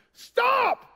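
high, loud shouted stop; full scream. Retold or imitated. Dad screaming at his son rolling toward a car, full shout. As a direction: yell it to freeze a child in his tracks. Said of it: "I scream at the top of my lungs".

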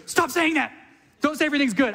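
high, pleading mock warning; mock alarmed protest. Friends scolding a happy boaster, mock alarmed. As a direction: hush the jinx, half-joking. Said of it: "everybody around you goes".

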